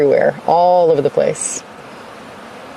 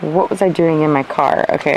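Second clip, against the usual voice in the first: ordinary talk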